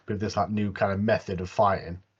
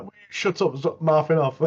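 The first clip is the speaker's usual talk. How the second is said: higher, fed-up mock retort; mock irritation; 5 semitones higher than usual. Skinny guy silencing the big guy, higher mock retort. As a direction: imitate a small guy telling off a loudmouth. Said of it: "that skinny guy's like".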